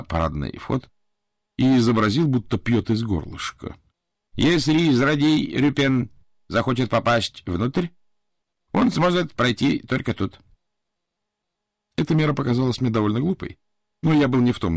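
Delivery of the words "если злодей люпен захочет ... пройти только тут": put-on slow, earnest Japanese-accented warning; a touch of Japanese accent, said solemnly. Japanese butler warns of villain. (японский акцент, серьёзно)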